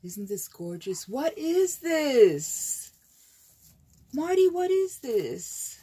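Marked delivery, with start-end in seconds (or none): 1.1-5.8 high, loud, drawn-out astonishment; astonished gushing, swooping high; amazed. Real, not acted. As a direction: exclaim in delighted disbelief. Amazed at a friend's gift.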